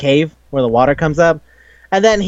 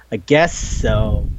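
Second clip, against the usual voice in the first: low, slow reluctant concession; imitated half-hearted acceptance; grudging agreement; 8 semitones lower, much slower than usual. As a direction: give in slowly, pitched way down. Acted grudging agreement to child.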